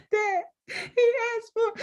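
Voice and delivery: high crying voice, breaking into a laugh; imitated crying. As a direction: replay her own sobbing call to her father, half laughing. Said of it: "I am crying".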